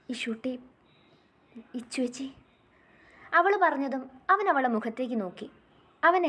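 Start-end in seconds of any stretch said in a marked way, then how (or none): 0.1-2.3 much softer, choked little words; tearful murmur. Acted. Little girl near tears hearing her sister's pet name. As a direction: barely get the names out through tears.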